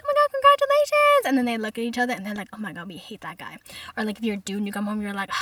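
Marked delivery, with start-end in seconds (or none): 0.0-1.3 high-pitched mock delight; gushing congratulations. Parents react to pregnancy news. 2.5-3.6 low, hushed parental aside; conspiratorial aside. Retold or imitated. Parents confide to each other.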